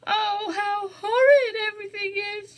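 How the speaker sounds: high, loud tearful wail; crying out. Acted. Jane bursting into tears over the cow, tearful wail. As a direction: sob it out in despair. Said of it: "she cried".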